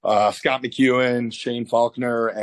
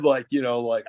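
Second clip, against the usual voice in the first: usual speaking voice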